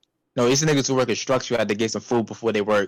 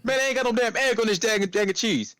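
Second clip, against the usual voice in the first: high, whiny-mad customer imitation; mock an indignant customer, pitched way up; going off; 11 semitones higher than usual. Customer complaining about a wrong sandwich order, high-pitched griping. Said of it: "Just going off and off".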